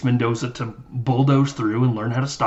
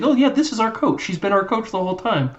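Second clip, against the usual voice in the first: mock kid voice; 8 semitones higher than usual. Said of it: "And he's like".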